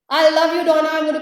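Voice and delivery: loud, high burst of affection; excited outburst. Real, not acted. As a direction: blurt out your love, thrilled. Gushing thanks to Donna.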